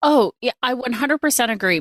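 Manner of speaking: ordinary talk, nothing marked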